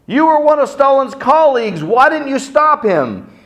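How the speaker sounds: high, loud accusing heckler; a heckler yells at Khrushchev; heckler's shout. Retold or imitated. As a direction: call out from the crowd, accusing. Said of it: "a heckler from the audience says".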